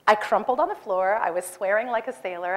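ordinary talk

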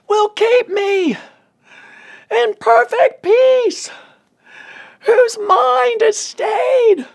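very high, loud, slow wail; full-force drawn-out scream of scripture; screaming to God. Retold or imitated. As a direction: cry out for help in long, held phrases. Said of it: "screaming to him".

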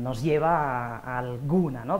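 ordinary talk, nothing marked

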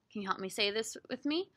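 usual speaking voice

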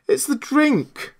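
high, drawn-out dismay. A narrator's reading. Marvel repeats to himself as his face whitens, dismayed. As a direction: stretch it out in high-pitched disbelief.

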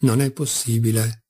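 ordinary delivery

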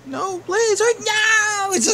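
high, loud panicked plea. Acted. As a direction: beg in panic. Trapped, begging.

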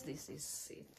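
soft whispered reveal. Real, not acted. Revealing the bag to viewers, whispered.

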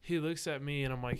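ordinary talk, nothing marked

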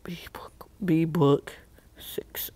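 whispered, barely voiced reading; whispering. Real, not acted. Reading a username to oneself, whispering. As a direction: read it under your breath. Reads username to self before saying it.

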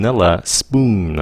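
"spoon" slow with long oo; correct spoon. Shown as a demonstration. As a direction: stretch out "spoon" as /spun/. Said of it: "Phát âm là spoon".

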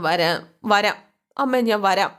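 slow, drawn-out giving in; reluctant promise. Retold or imitated. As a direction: give in slowly to a pleading mother. Son reluctantly promises mother. (മടിയോടെ പതുക്കെ)

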